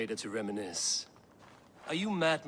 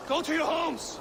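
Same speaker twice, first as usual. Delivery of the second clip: "Go to your homes!" shouted much higher and louder, weight on "your"; commanding shout; 7 dB louder than usual. Acted. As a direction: bellow "Go to your homes!" to send the crowd away. Dismissing the crowd, angry.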